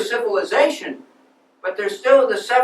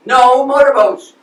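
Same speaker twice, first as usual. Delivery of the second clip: firm loud refusal; 3 semitones higher, 8 dB louder, slower than usual. Acted.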